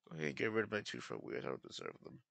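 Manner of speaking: very soft, quick, dropping away; defeated muttering. Real, not acted. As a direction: give up under your breath. Resigned after losing the race.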